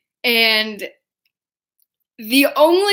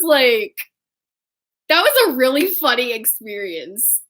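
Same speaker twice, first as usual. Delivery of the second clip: usual speaking voice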